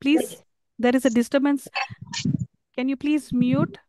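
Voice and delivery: ordinary talk, nothing marked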